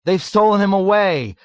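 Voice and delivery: high, loud panicked scream. A narrator's reading. Someone else spreading Dunbar's alarm, screaming. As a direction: scream the news out. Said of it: "screamed someone else".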